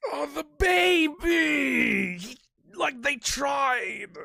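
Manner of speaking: high, drawn-out crying shout; pained crying outburst; anguished wail. Real, not acted. Distraught over sad sprite.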